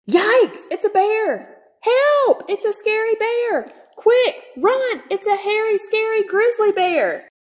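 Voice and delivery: high, loud panicked shrieking; panicked rats. Acted. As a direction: scream it like rats fleeing in terror.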